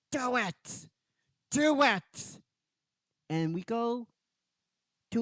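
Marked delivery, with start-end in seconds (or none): 0.1-2.4 high, restrained villain screech; Rita. Acted. Villain goads Goldar to strike, high pinched urging. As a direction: goad him in a high voice, held back in volume. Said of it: "And Rita's going".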